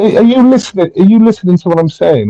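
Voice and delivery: high, insistent questioning; exasperated challenge. Real, not acted. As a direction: cut in high and demand attention. Challenges the other in argument.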